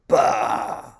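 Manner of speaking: loud dismissive bah; Grumpy's scoff. A narrator's reading. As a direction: scoff at her. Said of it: "said Grumpy".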